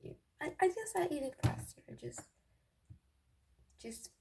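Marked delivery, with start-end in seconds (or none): none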